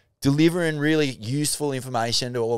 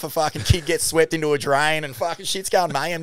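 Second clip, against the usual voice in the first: normal talking voice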